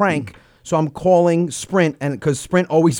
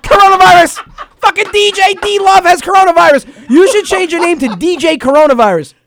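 excited yell; 9 semitones higher, 5 dB louder than usual. Acted. Mocking caller with coronavirus joke.

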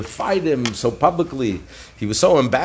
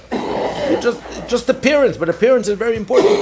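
high-pitched, hammed-up plea; insistent pleading; 9 semitones higher than usual. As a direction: insist like a ruler desperate to save face. Caesar pleading to save appearances.

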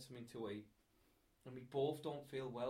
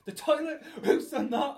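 much higher and louder mock outrage; shrill the outrage like she's just seen it; imitating outraged girlfriend; 12 semitones higher, 14 dB louder than usual. Girlfriend demanding who fouled the toilet, mock outraged, much higher and louder. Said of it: "She's like".

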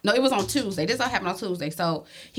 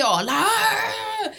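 mock son taunting; 10 semitones higher than usual. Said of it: "So he go".

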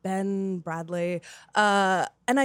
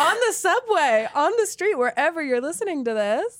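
high, loud, laughing emcee call; rally the listeners like a crowd; hype announcer; 7 semitones higher, 5 dB louder than usual. Acted. Co-host rousing listeners for the guest, hype announcer.

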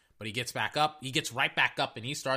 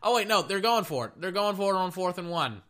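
sudden surprise; 6 semitones higher, 6 dB louder than usual. Real, not acted.

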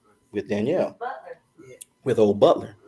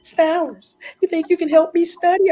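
high, loud mimicry of her; imitated squad leader; 8 dB louder than usual. Squad leader asking a soldier to help her study, high loud imitation. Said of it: "she said".

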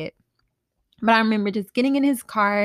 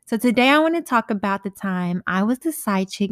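usual speaking voice

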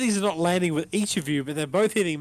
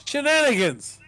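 calling shenanigans; 8 semitones higher, 3 dB louder than usual. Retold or imitated. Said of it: "Super Troopers quote".

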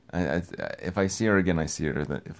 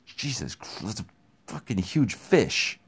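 awed; 4 semitones higher than usual. Retold or imitated. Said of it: "I was just like".

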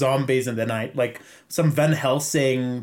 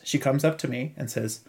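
usual speaking voice

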